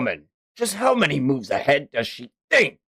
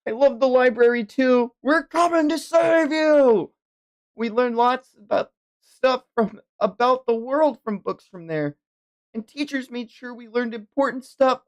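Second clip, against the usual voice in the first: put-on higher, childlike character read; earnest young child; 9 semitones higher than usual. Child telling an elder about school and library, high and earnest.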